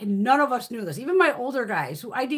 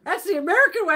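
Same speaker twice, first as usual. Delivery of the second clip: higher-pitched mimicry of his comeback; imitating husband; 6 semitones higher, 4 dB louder than usual. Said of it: "he goes".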